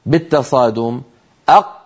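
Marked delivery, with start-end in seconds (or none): 1.5-1.8 أق with a tight closed qaf, no release; clipped qaf stop. Shown as a demonstration. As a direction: press the qaf shut in أق. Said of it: "جربوا هكذا". (قاف ساكنة مضغوطة بلا قلقلة)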